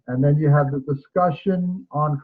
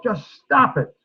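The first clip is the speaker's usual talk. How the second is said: sharp mock yell, a bit higher; retold yell; 6 semitones higher than usual. Student teacher yelling at a girl mid-tantrum, mock sharp yell. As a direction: snap it like an exasperated student teacher. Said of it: "the student teacher started yelling at the girl".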